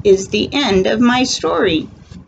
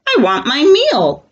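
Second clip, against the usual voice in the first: higher, louder demanding puppy; dog voice; 7 semitones higher, 4 dB louder than usual. Acted. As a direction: whine for dinner like a puppy. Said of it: "says the dog".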